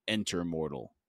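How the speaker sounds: low, soft rasp of a god; low raspy invitation. A narrator's reading. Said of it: "then a voice rasped".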